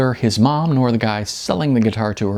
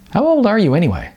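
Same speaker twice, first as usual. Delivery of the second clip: higher, louder, fast curious question; curious Sean; 11 semitones higher, 3 dB louder, faster than usual. Acted. Sean asks Icon's age.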